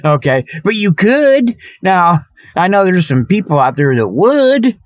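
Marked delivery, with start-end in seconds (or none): none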